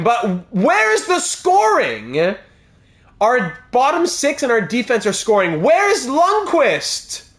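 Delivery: exasperated. Real, not acted. Streamer demanding where his top scorers are, exasperated and slow. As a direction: stretch out every word in frustration. Star players not scoring again.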